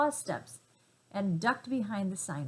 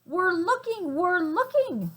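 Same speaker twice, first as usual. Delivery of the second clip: much higher, chirpy monkey voices; little monkeys; 10 semitones higher, 6 dB louder, slower than usual. Acted. Monkeys combing the gorilla's chest, high monkey chatter. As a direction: squeak it out like busy little monkeys. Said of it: "said the six little monkeys".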